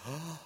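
quiet mock gasp from onlookers; shocked gasp. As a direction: gasp as the scandalized crowd would. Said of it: "and people are like".

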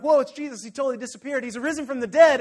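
high, loud, breathless-style rush; excited news-breaking. Retold or imitated. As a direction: blurt out astonishing news to friends. Excited disciples bursting in with news.

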